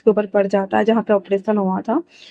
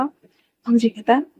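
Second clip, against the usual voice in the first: usual speaking voice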